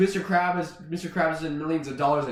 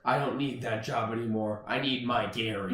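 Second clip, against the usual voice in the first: SpongeBob; 7 semitones lower, 6 dB quieter than usual. Acted. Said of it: "And SpongeBob says".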